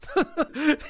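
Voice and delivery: high burst of laughter; loud guffaw. Acted. Hero guffawing at his friend's joke. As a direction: break out guffawing. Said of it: "Я заржал".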